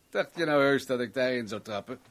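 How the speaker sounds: ordinary delivery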